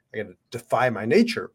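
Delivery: usual speaking voice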